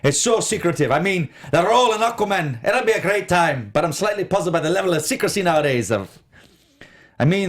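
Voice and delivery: put-on a light Scottish accent, mostly in the speaker's own voice; reading the actor's quote in a mild Scottish lilt; Scottish impression. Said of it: "say it like he would say it".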